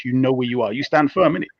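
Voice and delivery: ordinary talk, nothing marked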